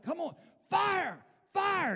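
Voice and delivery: high, slow shouted "fire" like a revivalist; mock fiery evangelist yell; revival preacher shouting. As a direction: hammer each word at the congregation. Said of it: "and he's like".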